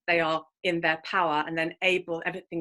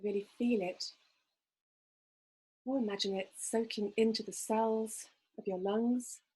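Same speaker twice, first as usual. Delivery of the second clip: guided meditation; 6 dB quieter than usual. Acted. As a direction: gently guide listeners into calm. Leads a breathing visualization.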